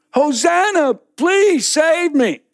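high, slow desperate cry; desperate plea. Acted. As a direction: beg for rescue. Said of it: "trapped man pleads".

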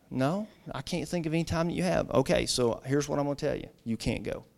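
hushed retold exchange, sinking low at the end; gentle refusal. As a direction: break the bad news very softly. Father gently breaks bad news.

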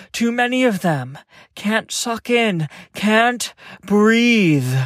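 high, strained, breathless panic; panicked gasping. A narrator's reading. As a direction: choke it out in panic. Narrator suffocating, panicking.

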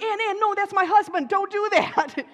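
high, loud urgent calling out; alarmed shout. Retold or imitated. She shouts to stop her protective friend from decking her husband. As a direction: call her off fast before she swings.

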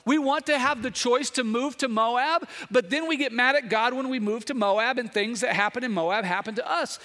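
fiery preacher hammering the congregation; preaching at full force. Real, not acted. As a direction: drive the point home from the pulpit.

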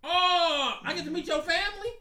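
high, bright excited oh; delighted surprise at the news; pleasantly surprised. Real, not acted. Girlfriend plans outing, he reacts.

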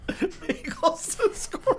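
squeezed out through high laughter; laughing. Real, not acted. Host repeating the comic's bagels bit, laughing. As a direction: repeat it slowly while cracking up.

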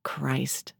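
low, soft curse under the breath; muted aside. A narrator's reading. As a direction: swear quietly with the phone covered. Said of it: "a hand over the mouthpiece".

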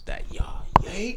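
ordinary delivery

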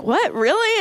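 high disbelieving "what, really"; surprised. Retold or imitated. Teller retelling own shocked reaction to [name]. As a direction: jump up in pitch, amazed.

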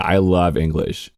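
ordinary talk, nothing marked

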